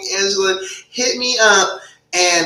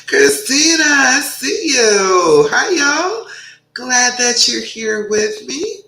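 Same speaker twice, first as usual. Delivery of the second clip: excited greeting; 6 semitones higher, 3 dB louder, slower than usual. Real, not acted. Host greeting viewers by name.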